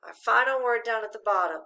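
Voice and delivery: normal talking voice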